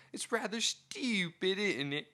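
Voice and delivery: put-on high, soft, slow posh British accent. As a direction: put on an upper-class Chelsea accent. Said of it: "the posh sort of Chelsea upper class accent".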